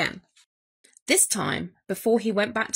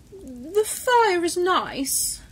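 slow, high, drawn-out declaration; announcing Bear; 7 semitones higher, much slower than usual. A narrator's reading. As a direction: announce it with awkward ceremony. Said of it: "Bear announced".

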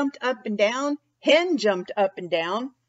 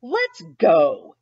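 a touch of grumpy Cow, louder and lower; mild grumpiness; 5 semitones lower, 6 dB louder than usual. Acted. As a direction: grumble it as Cow gives up. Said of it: "Cow said grumpily".

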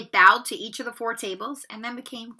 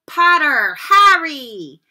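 higher, louder, slow name call; 5 semitones higher, 13 dB louder, much slower than usual. Acted. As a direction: draw the name out to the hall. Calling names.

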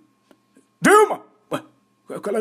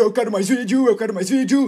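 usual speaking voice

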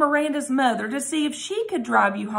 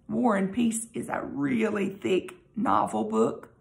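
plain reading voice

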